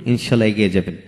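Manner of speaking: ordinary talk, nothing marked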